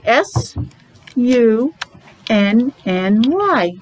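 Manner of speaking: slow letter by letter; spelling out letters. Shown as a demonstration. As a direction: spell it for children. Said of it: "And we spell".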